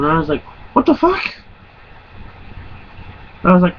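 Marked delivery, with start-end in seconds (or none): 0.7-1.2 squeaky high-pitched question; shocked disbelief. Retold or imitated. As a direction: shoot way up in pitch, stunned. Retold shock at judge's words.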